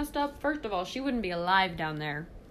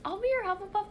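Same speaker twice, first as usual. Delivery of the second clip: eager Hufflepuff; 10 semitones higher, faster than usual. Retold or imitated. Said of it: "a Hufflepuff would be like".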